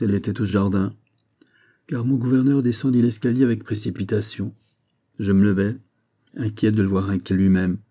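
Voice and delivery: plain reading voice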